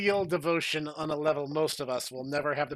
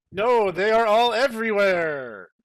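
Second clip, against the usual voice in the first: higher, much louder panicked shout; panicked cry; 6 semitones higher, 11 dB louder than usual. Acted. Another guy panics at the Combines, loud and high. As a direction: scream that there is no way out. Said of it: "another guy said".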